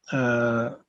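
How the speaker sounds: normal talking voice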